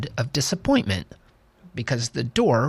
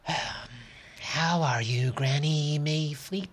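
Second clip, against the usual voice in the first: soft, slow, kind bedside voice; gentle doctor voice; 4 semitones higher, 7 dB quieter, much slower than usual. Acted. Doctor gently asks patient.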